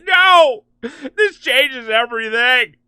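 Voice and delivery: loud, high melodramatic cry; mock despair at full volume; hammed-up anguished Superman. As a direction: wail like a ruined hero. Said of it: "we're ad-libbing. We're improvising".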